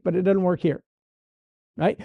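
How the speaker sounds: normal talking voice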